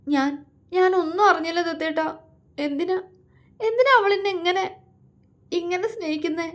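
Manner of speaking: high, tearful, breaking voice; sobbing question. A narrator's reading. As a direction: plead through sobs. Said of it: "അവൾ തേങ്ങി കരഞ്ഞുകൊണ്ട് ചോദിച്ചു".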